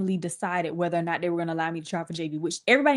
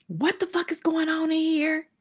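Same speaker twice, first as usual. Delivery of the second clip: imitating furious mom; 10 semitones higher than usual. Mom storms in at the team.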